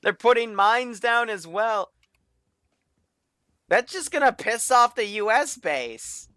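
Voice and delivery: high, loud Aussie narrator; excited Australian documentary host; Australian accent. Pretend. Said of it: "narrate all this in the Australian accent".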